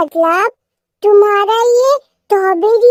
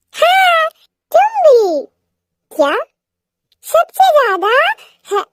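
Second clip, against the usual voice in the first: put-on high, bubbly childlike voice; giddy little girl; 9 semitones higher than usual. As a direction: gush like an excited child. Wife answers in high giddy voice.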